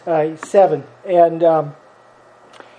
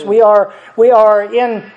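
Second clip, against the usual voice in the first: ordinary talk, nothing marked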